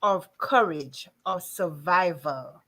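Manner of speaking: slow, weighty delivery; slow emphatic list. Real, not acted. Host describing the anthology, slow and weighty. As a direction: lay each phrase down slowly.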